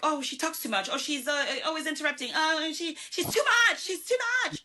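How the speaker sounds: escalating mock disapproval in a high voice; mimicking critics. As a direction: parody gossips griping, pitch rising to a squeal. Mimics people who misjudge her.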